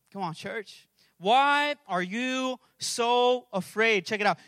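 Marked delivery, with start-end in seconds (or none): 1.2-4.1 stretched, deliberate words; measured challenge, each word held; slow, weighty question. Acted. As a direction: put it slowly to the whole church. Repeats the scripture question to church.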